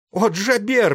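high, loud hysterical shriek; hysterical cry. A narrator's reading. Wounded sailor crying out in battle, hysterical. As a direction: cry out in panic, pitch leaping up. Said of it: "послышался истерический крик".

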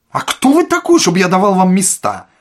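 very high, loud outraged question; indignant yell. A narrator's reading. Administrator refusing Ostap seats, shouting indignantly. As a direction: explode at the nerve of the request.

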